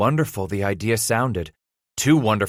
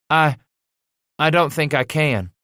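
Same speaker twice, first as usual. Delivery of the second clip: put-on higher, hesitant boy's voice; frightened; 7 semitones higher, 4 dB louder than usual. A narrator's reading. Son admitting to angry father he can't read, frightened. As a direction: falter on the first word, timidly. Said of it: "Titus grew frightened".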